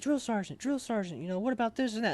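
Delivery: high, rushed eager questions; eager trainees. Retold or imitated. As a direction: pitch up like trainees crowding the sergeant. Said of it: "everybody's asking him questions like".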